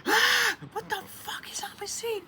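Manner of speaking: high shriek, then a hushed whisper; shriek, then whisper in disgust; shocked scream then whisper. Retold or imitated. Finding slime on her car seat, a shriek then a whisper. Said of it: "I was like".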